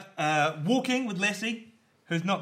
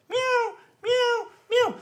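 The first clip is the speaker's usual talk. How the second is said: very high, loud urgent meows; a cat trying to raise the alarm; cat meowing; 5 dB louder, much slower than usual. Retold or imitated. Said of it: "starts going".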